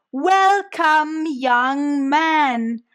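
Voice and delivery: put-on drawn-out, loud Welcome, young man; almost shouting. A narrator's reading. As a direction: shout it slowly to a foreigner. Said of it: "now much louder, almost shouting".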